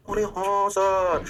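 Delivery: long, slow sustained "yes sir"; put-on chorus of realization; mock student agreement. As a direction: draw out every vowel in agreement. Acts out class's drawn-out agreement.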